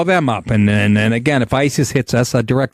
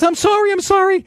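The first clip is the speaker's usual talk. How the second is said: much higher panicked pleading; scared kid. Retold or imitated. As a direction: beg like the terrified clerk. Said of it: "And the kid goes".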